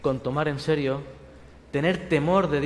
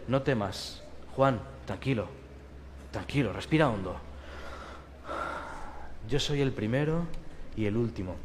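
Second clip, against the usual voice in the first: low, soft and gentle; calming voice; 6 semitones lower, 8 dB quieter than usual. Acted. Jesus calming John fallen at his feet, softly. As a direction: settle a panicked friend.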